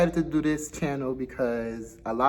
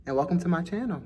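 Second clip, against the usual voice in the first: upbeat intro; 7 semitones higher, 3 dB louder, faster than usual. Shown as a demonstration. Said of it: "take three".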